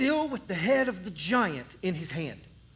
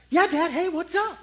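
put-on a higher, louder carefree kid voice; cheerful boy; 9 semitones higher, 5 dB louder than usual. Boy greets his dad, higher and carefree. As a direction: breeze in like a kid without a care.

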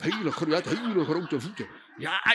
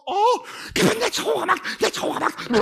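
high wail fading to a breathy moan; wailing Martha; 13 semitones higher, 3 dB louder than usual. Acted. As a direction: lament the ruined floor.

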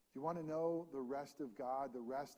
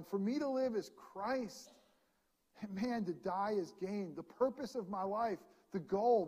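higher and louder, driving sermon voice; full-force preaching; 5 semitones higher, 6 dB louder than usual. Acted. Pastor exhorting the church, preaching at full force. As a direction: preach it out to the congregation with rising fervor.

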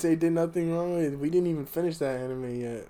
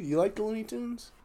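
usual speaking voice

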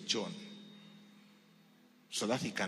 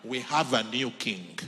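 loud proclaiming cry of the crowd; mock crowd shout; 3 semitones higher, 12 dB louder than usual. Crowd in Rome hails king.

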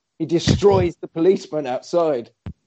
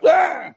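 short high-pitched yell; imitated startled scream; quick hammed-up "ah"; mock cry; 3 dB louder than usual.

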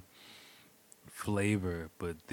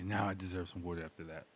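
normal talking voice

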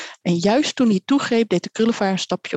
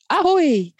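long, high-pitched bird call; bird cry; 9 semitones higher than usual. A narrator's reading. Bird crying out above the boy's head, high call. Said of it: "klonk het boven zijn hoofd".

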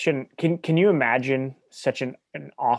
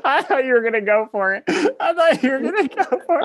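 high, laughing through the words; cracking up mid-sentence; laughing hard; 12 semitones higher, 5 dB louder than usual. Real, not acted. Friend teasing the other for backing out, laughing.

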